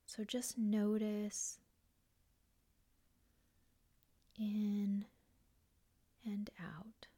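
guided meditation. Real, not acted. Host guiding listeners through grounding and breath, calm meditation voice. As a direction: guide them gently into stillness, one unhurried phrase at a time.

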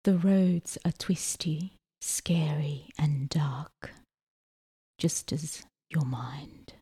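hushed, whispery pick-up line; creepy whisper. Acted. Podcaster reads her last bus pick-up line to co-host, creepy whisper. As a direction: let it sink into an ominous whisper.

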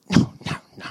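breathless whisper of disbelief; hushed no no no. Retold or imitated. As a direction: whisper it, not daring to believe. Fans realise it's [name].

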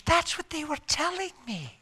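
thin, high imitation of the wife; imitating a frail patient; weak trach voice. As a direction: push it out small and high. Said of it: "had a whisper 'cause of the trach".

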